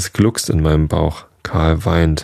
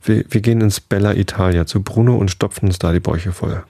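usual speaking voice

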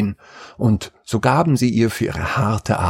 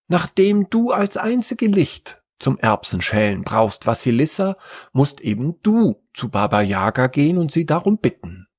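stepmother announcing; 6 semitones higher than usual. A narrator's reading. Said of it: "verkündete die Stiefmutter".

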